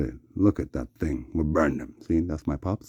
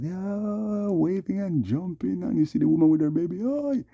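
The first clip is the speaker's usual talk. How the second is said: much higher, gleeful mock cheering; imitating happy crowd; 11 semitones higher than usual. Showing people waving and jumping, excited imitation.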